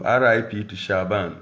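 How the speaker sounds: usual speaking voice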